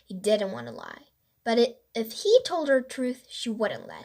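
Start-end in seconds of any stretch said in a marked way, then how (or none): none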